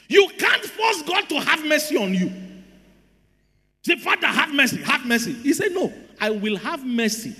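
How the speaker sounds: high-pitched, emphatic sermon delivery; preacher driving the point home; full-force preaching. Real, not acted. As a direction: preach it with urgent force to the congregation.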